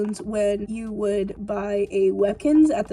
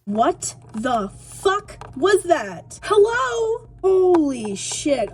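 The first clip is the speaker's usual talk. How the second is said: shocked, high and loud; startled; 7 semitones higher, 4 dB louder, much slower than usual. Real, not acted. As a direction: jump out of your skin. Startled by sudden countdown sound.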